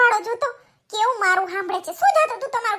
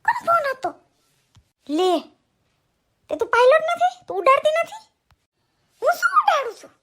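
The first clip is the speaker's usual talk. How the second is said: indignant daughter; 6 semitones higher than usual. Acted. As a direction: challenge mom, half offended, half teasing. Daughter confronts mother about call. (માને સવાલ કરતી નારાજ દીકરી, ઊંચા અવાજે)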